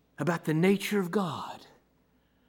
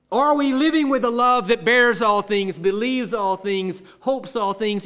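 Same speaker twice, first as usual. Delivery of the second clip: raised, ringing sermon voice; emphatic preaching; 6 semitones higher, 7 dB louder than usual. Real, not acted. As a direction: build a rousing run of challenges to the congregation. Preaches at full force.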